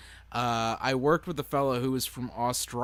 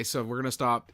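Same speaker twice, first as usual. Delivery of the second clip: normal talking voice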